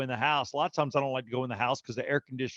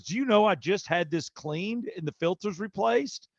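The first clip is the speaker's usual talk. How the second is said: higher, indignant mock scolding; angry father-in-law; 7 semitones higher than usual. Father-in-law scolding son-in-law in hot tub, imitated anger. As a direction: retell his angry challenge, pitch raised. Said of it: "he's, he's angry".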